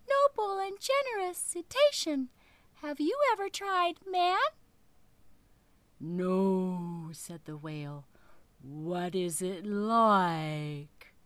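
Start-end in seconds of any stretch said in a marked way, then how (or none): 0.1-4.4 high, louder squeaky fish voice; little fish voice. Acted. Little fish flatters the whale, high and piping. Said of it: "in a little 'stute voice". 6.0-7.1 low, very slow whale voice. Acted. Whale tells the fish he is hungry, low and slow. 8.6-11.1 low, very slow whale voice. Acted. Whale tells the fish he is hungry, low and slow.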